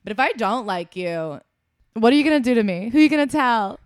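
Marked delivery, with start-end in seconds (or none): 1.9-3.8 louder mock challenge, daring anyone to stop her; defiant dare. Quitting employee daring her coworker to tell, louder defiant taunt. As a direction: taunt it like you have nothing to lose.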